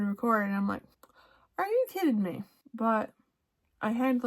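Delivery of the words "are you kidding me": high-pitched, incredulous complaint; retold exasperation. She finds the recording only just started, exasperated disbelief.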